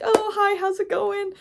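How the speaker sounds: high, bright mock-friendly hi; fake cheerful greeting. Mocking people who pretend all is normal, fake cheerful. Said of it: "I can't put on that fake shit no more".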